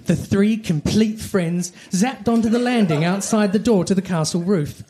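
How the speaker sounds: plain reading voice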